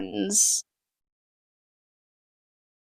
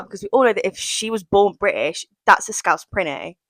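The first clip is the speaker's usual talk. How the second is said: ordinary delivery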